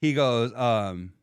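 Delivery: usual speaking voice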